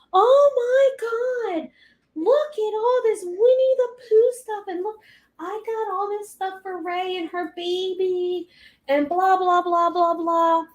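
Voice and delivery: high-pitched gushing, held up high all through; mock an over-thrilled relative; excited aunt. Aunt gushing to mom about baby gifts, excited. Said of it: "She was so excited".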